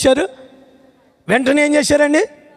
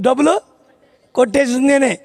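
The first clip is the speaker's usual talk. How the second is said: ordinary delivery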